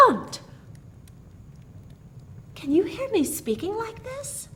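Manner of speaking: breathless, high astonished question; a high, amazed half-gasp. A narrator's reading. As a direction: gasp it out in disbelief. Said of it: "Mary gasped".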